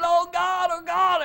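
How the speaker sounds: high, loud panicked cry; hammed-up terror; freaking out. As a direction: Moses wailing in a mock panic. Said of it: "Moses is freaking out".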